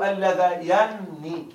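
اللذين with a doubled nun, drawn out slowly. Shown as a demonstration. Teacher showing students the right reading, slow. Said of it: "لا تستطيع أن تنطق هكذا".

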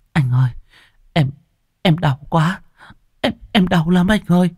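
high, halting wife in labour; pained stammer. A narrator's reading. Wife in labour calls to her husband, pained stammer. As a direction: stammer it out, pitched high through the pain. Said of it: "miệng lắp bắp".

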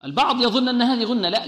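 usual speaking voice

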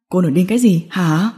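low, cold male retort; coldly. A narrator's reading. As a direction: cut her off with a cold, low question. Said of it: "Hạ Cẩm Trình lạnh nhạt nói". (giọng trầm, lạnh nhạt)

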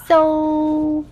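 high, drawn-out "so" before each name; Asa. Retold or imitated. Cohost teasing the host by mimicking Asa, high and drawn out. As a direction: imitate a child calling on parents, stretched and high. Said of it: "You sound like Asa".

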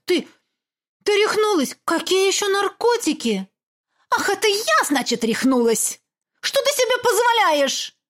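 high, loud indignant outburst; angry mother. Acted. Mother snapping at Olaf, high and loud. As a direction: hurl the words back in outrage.

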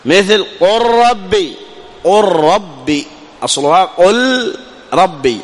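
slow demo with قل ربي blended as "قرّبي", the lam absorbed into a doubled ra; drawn out so the merge is heard; teacher slowly sounding قل ربي as one word; merged lam into ra. Shown as a demonstration. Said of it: "فأدغمنا اللام في الراء".

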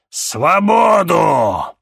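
slow, high shouted demand. A narrator's reading. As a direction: bellow the demand. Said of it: "потребовал демон".

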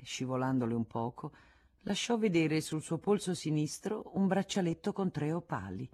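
usual speaking voice

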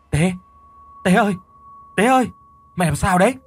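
calling out loud and high. Acted. Older brother calling his fallen brother, loud worried call. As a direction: call his name to wake him. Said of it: "rồi gọi".

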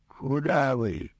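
mild cry after a departing doctor; calling after someone. A narrator's reading. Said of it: "закричал ему вслед водопроводчик". (негромко кричит вслед)

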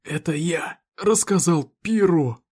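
slow, rasping words through pain; hoarse through pain. Acted. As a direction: wheeze it out, hurting. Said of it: "сквозь боль прохрипел Смоук". (хрипло, сквозь боль)

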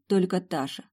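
plain reading voice

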